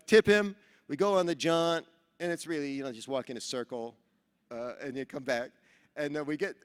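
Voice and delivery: ordinary talk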